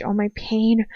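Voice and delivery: normal talking voice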